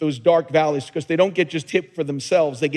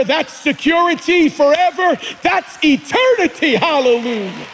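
higher and louder, building with each phrase; preaching at full force; 5 semitones higher, 4 dB louder than usual. Acted. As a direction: climb into a rousing preacher's call.

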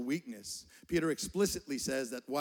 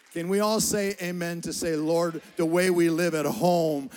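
preaching at full force; 10 dB louder than usual. Acted. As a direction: lift the congregation to an amen. Preaching call to amen.

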